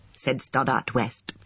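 usual speaking voice

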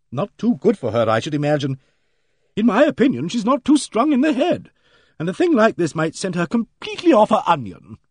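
loud, high, brisk know-it-all; hearty bossy lady. Acted. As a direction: play her booming and self-assured. Aimee Griffith's hearty voice.

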